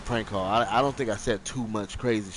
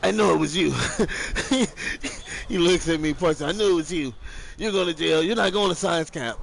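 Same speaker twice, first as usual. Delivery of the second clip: higher, louder accusation; angry accusing dad; 6 semitones higher, 4 dB louder than usual. Retold or imitated. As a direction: point the finger and accuse. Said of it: "I see dude's dad, like".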